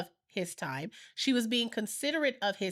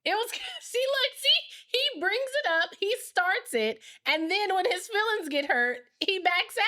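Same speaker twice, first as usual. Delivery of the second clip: high exasperated protest; 10 semitones higher than usual. Real, not acted. Wife laughs, protests husband.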